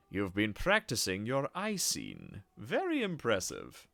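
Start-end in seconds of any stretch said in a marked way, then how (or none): none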